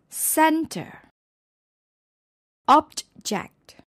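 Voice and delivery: CENter, OBject, openings punched; stress on first syllable. Shown as a demonstration. Teacher showing nouns, first syllables stressed in "center", "object". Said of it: "the stress is on the first syllable".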